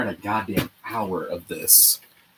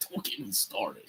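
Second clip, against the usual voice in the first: much softer, trailing repeat under the breath; muttered repeat; 10 dB quieter than usual. Real, not acted. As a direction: say it again to yourself, much softer.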